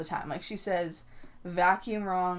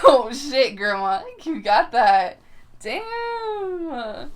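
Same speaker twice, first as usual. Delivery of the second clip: loud, hammed-up amazement; mock-stunned admiration, loud; impressed shock; 6 semitones higher, 9 dB louder, much slower than usual. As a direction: react big, stretching out a high final word. Acts out impressed shock at grandma.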